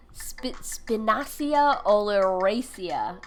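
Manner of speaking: "Spinacia oleracea" said very slowly, syllable by syllable; slow careful Latin name. Shown as a demonstration. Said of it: "That's a mouthful".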